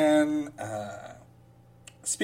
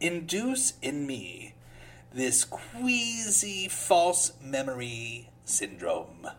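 slow mock reading; 3 semitones lower, 5 dB quieter, slower than usual. Slow mocking read of quote.

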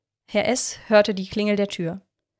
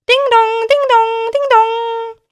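sung high and loud like a bell; doorbell chime; 10 dB louder, slower than usual. A narrator's reading. Narrator voicing the doorbell that wakes Herr S, high chime. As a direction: ring it out like a doorbell. Doorbell rings three times.

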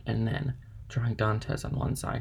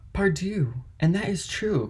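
higher-pitched, pleased exclamation; delighted cry; 7 semitones higher than usual. A narrator's reading. Shipowner hearing of his uncle, delighted cry. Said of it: "cried the owner, greatly delighted".

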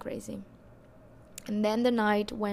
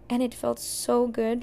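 ordinary delivery

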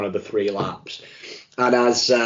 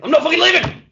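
very high, loud imitated yell; retold defiant shout; 7 dB louder, faster than usual. Imitating Gibbo refusing to leave the party, shouting. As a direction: erupt into a high-pitched mock tantrum.

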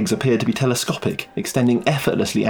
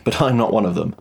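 usual speaking voice

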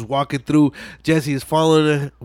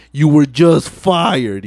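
slow firm verdict; 5 dB louder, much slower than usual. Retold or imitated. Toretto firing Brian, retold.

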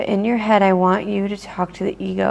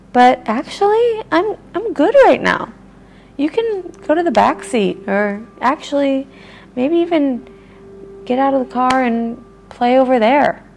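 much higher and louder, playful and cheery; playful loving voice; 6 semitones higher, 5 dB louder than usual. Shown as a demonstration. Speaker cheerfully telling her ego to go play elsewhere, high and bright. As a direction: send it off to play like a kid. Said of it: "talking to it in a loving voice".

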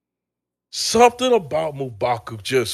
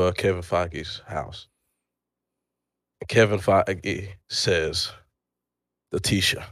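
low, deliberate storyteller delivery; mock screenplay reading; 7 semitones lower, 7 dB quieter than usual. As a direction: read stage directions gravely, with long pauses.

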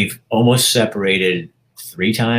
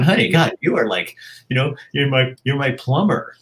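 higher, gushing mock praise; bragging to wife; 7 semitones higher than usual. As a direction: heap playful praise on her, voice up high. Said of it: "I brag her up 'cause I'm going".

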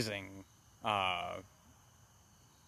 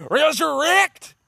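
huge high drawn-out yell; hammed-up shouted command to heaven; mock preacher command; 12 dB louder, slower than usual. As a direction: bellow it like an over-the-top preacher raising the dead. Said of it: "then he's all like".

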